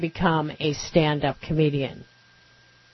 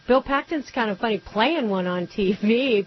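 ordinary talk, nothing marked

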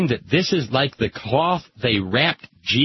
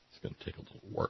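whispered aside; 19 dB quieter, much faster than usual. Real, not acted. Muttered aside.

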